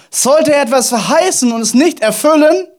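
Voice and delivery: loud, high challenge to the congregation; rousing rhetorical question. Real, not acted. Preacher giving the congregation another try, rousing loud question. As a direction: throw the question out forcefully.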